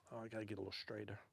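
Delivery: fast muttered aside. Real, not acted. Aside to self while fishing.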